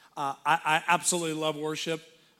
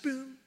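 brief high boom, softer; short-lived note; 8 semitones higher, 4 dB quieter than usual. Shown as a demonstration. Showing a note without sustain, brief high boom. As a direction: imitate a quick staccato piano note. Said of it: "short-lived".

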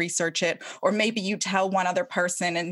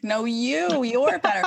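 higher, louder gushing protest; hammed-up flattering back-and-forth; mock love fest; 6 semitones higher, 5 dB louder than usual. Host mocking a mutual praise fest, gushing. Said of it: "I don't wanna go on to a love fest".

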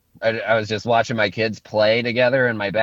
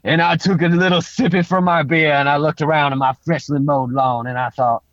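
put-on Creole accent. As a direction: drift lazily through it in a Creole accent. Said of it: "Tell me your terrific thought in a Creole accent".

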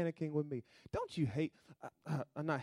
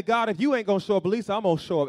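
loud and high, voicing the stubborn believer; defiant shout; 6 semitones higher, 12 dB louder, faster than usual. Preacher voicing believers who take matters into their own hands, shouting. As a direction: fling it at God in open defiance.

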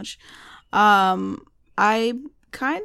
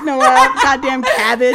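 loud; 8 dB louder than usual. Real, not acted. Mock scolding cohost.

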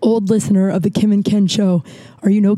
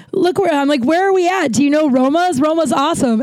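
higher, lively gushing to a friend; excited; 6 semitones higher than usual. Retold or imitated. Bragging to Ken about the diner, pitched-up excitement. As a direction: show off the find, bubbling over.

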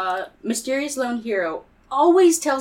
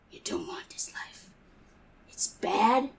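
hushed, grave whisper; 3 semitones lower, 7 dB quieter than usual. Acted. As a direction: breathe it like a weary gunslinger. Cowboy hero to kid.

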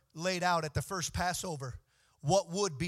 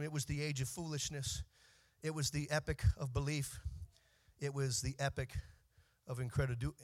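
soft, measured reading of a famous opening; hushed literary recitation; 3 semitones lower, 3 dB quieter than usual. Shown as a demonstration. Preacher softly reciting a classic opening to the congregation. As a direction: recite it gently, savouring each line.

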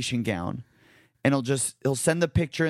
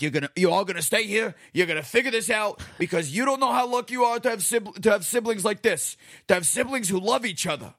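higher, fast, pleading rant of a dad; mock upset dad; 7 semitones higher, faster than usual. Dad pleading with his fighting kids, fast and higher. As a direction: rush it out like a dad begging his kids to make up.

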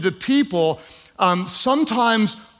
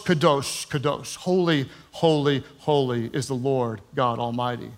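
much lower and softer, hushed awe; reverent hush; 7 semitones lower, 7 dB quieter than usual. Acted. Preacher proclaiming God's holiness in a hushed, awed voice. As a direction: let it sink to a reverent near-whisper.

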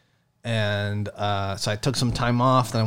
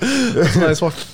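admiring; 5 semitones higher, 3 dB louder, slower than usual. Real, not acted. Admiring friend's Rolex.

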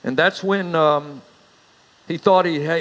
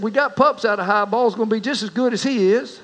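lightly voiced son, raised and pleading; son coaxing; 5 semitones higher than usual. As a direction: reassure pa with a hint of a country boy. Son Marcel voiced in joke.

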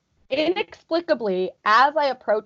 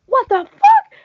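high, loud yelp of disbelief; acted-out startled shout; retold yell; 14 semitones higher, 9 dB louder, slower than usual. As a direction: yell it to a roommate in shock. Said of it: "I, like, yelled at my roommate".

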